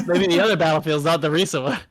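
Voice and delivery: words through a laugh. Real, not acted. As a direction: laugh as you say it. Laughing while talking.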